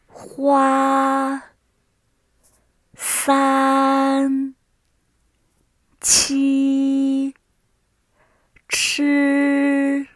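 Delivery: each syllable from Ma to ge drawn out high and level; first tone. Shown as a demonstration. Teacher leading word drill in first tone, high and flat. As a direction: hold Ma, Ta, ba, Hua on one loud high note. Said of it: "This is how we pronounce the first tone".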